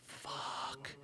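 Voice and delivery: breathy unvoiced fuck; whispered curse. Watching the kid crash, cursing under breath. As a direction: let it slip under your breath. Narrator's muttered reaction.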